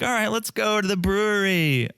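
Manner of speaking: high, hammed-up party-girl enthusiasm; mock party cheer. Mother and daughter heading out, mock party cheer. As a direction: fake a carefree call to go out drinking. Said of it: "It's not gonna be like".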